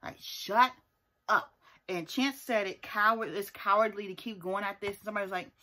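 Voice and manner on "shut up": yelling at the screen. Retold or imitated. Viewer telling the cast to shut up, yelling. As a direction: punch each word in an exasperated shout. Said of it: "yelling up the screen".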